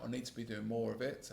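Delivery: normal talking voice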